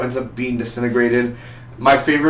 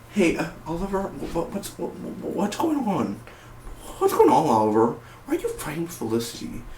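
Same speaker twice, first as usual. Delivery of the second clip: higher-pitched, hesitant stammer; Barry; 6 semitones higher, 6 dB quieter than usual. Retold or imitated. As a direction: stumble over the words, high and mostly soft. Acts out Barry arriving confused.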